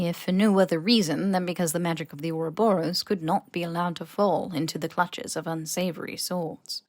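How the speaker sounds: ordinary delivery, nothing marked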